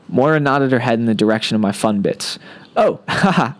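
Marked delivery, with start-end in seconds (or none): 2.8-3.5 sheepish laugh. A narrator's reading.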